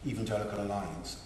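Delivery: usual speaking voice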